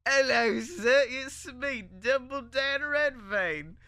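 put-on high, loud, terrible British accent; stretched-out, hammy British introduction; worst British accent. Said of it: "like, the worst British accent".

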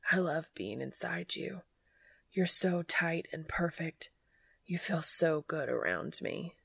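hushed and breathless, close; breathy whisper. A narrator's reading. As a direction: breathe it out softly. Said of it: "he gasped".

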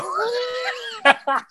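high-pitched burst of laughter; high wordless cackle of amusement. Real, not acted. Speaker laughs at own story.

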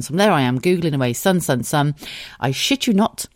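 ordinary talk

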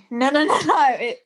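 normal talking voice

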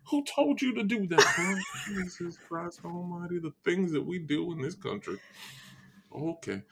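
hammed-up Southern bluster winding down; Foghorn Leghorn. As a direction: grumble like an exasperated Southern gentleman. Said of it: "'cause my southernness".

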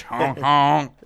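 loud, goofy honk noise. Retold or imitated. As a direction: honk like a cartoon. Imitating Chappelle's Show skit noise.

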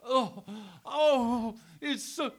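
hammed-up X-rated video moan; imitating moaning. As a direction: ham up the X-rated moans. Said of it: "you hear him".

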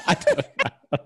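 slow, high, laughing reply. Real, not acted. Friend laughing back about the boa. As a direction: giggle the words out.

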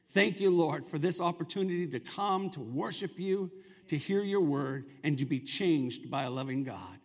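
subdued pastoral prayer, easing down to the amen. Real, not acted. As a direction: settle down and pray earnestly to the Father. Leads the congregation in prayer.

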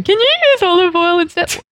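high-pitched mimicry of a silly question; mocking incredulity. Friend mocking a TV host's olive oil question, incredulous. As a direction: parody the question, then squeak in disbelief.

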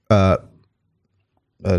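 normal talking voice

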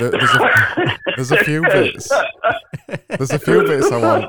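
talking through laughter; words bubbling with laughs; laughing. Real, not acted. Laughing while speaking.

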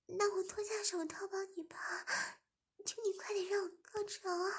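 bargain, then beg; high pleading woman's voice. A narrator's reading. Zhimin pleading.

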